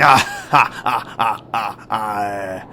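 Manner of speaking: a knowing laugh at the question; a long, amused laugh. Acted. Old guide amused by her question.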